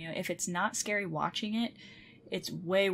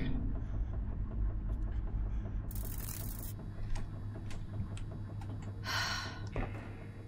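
long, breathy exhale; long sigh; 9 dB quieter than usual. Real, not acted. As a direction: let out a long weary sigh.

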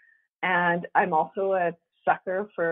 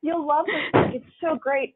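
higher-pitched, gushing recommendation; enthusiastic school librarian; 5 semitones higher than usual. Retold or imitated. School librarian recommending a book to a kid, enthusiastic. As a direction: pitch it up and sell the book eagerly. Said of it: "my librarian at the school was like".

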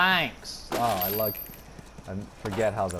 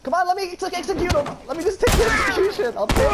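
high, loud and fast, frantic; frantic pleading; 6 dB louder, faster than usual. Real, not acted. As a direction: rush the words out in a panic. Begging to execute the enemy.

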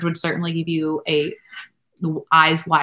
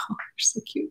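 put-on hushed, high, soft whisper over a sleeping child; adoring whisper; 8 semitones higher, 9 dB quieter than usual. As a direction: breathe it softly so the baby stays asleep. Parent watching sleeping child.

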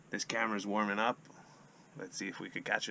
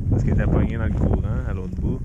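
loud urging; 3 semitones lower, 14 dB louder than usual. Real, not acted. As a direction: shout instructions over the ice. Coaching partner landing fish.

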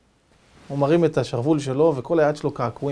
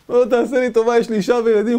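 louder, higher frantic begging; desperate pleading; 6 semitones higher, 5 dB louder than usual. Retold or imitated. Captive begging the cannibal chief, frantic and loud. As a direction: plead for your life. Captive begs for his life.

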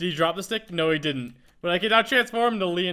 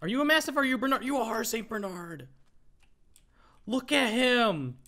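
all excited; 5 semitones higher than usual. Real, not acted. Player recognizing a Saint Bernard, all excited. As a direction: gush over the dog in a higher voice. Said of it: "I'm getting, like, all excited".

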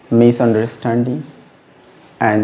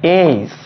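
"Ace" held longer and pitched higher; drawn-out, emphasized letter; 5 semitones higher than usual. Shown as a demonstration. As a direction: stretch "Ace" with firm emphasis. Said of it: "You need little more duration and".

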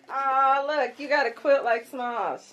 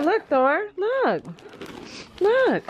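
excited pet talk; 8 semitones higher, slower than usual. Real, not acted. Coaxing the dog to look.

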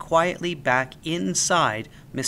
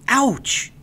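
higher, louder yelp of pain; pained cry; 11 semitones higher, 6 dB louder than usual. A narrator's reading. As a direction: yelp as the book hits. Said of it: "he cried".

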